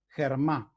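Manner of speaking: "germá" con G como jota española; pronunciación según la escritura. Shown as a demonstration. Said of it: "lo digo como se escribe, no como se pronuncia". ("germá" leído a la española, empezando con jota)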